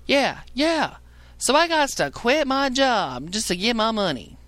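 high, slow, dim-witted caller voice; high mock voice of a caller misunderstanding; mocking a confused caller. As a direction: play the caller who just doesn't get it. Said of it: "What I said was".

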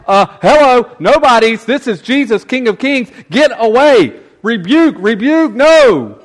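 loud, high mock brush-off of the nobodies; dismissive disciples. Disciples turning the children away, loud and dismissive. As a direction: shoo them off like self-important guards. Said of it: "are like".

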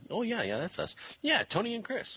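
high chirpy imitation of a fan; high mock lady voice. Podcaster imitating the lady who mixed up names, high mock voice.

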